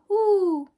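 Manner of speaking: hoo sung up high like an owl; high owl hoot. Shown as a demonstration. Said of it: "The owl will say".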